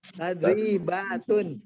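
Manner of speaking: drawn-out Tadribatun; slow model reading. Shown as a demonstration. Teacher repeating the word for students, slowly stretched.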